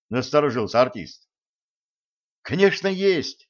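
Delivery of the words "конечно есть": high eager exclamation; exclaiming. A narrator's reading. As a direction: blurt it out, eager. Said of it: "воскликнул Джим". (восторженно восклицая)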